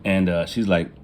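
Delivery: ordinary delivery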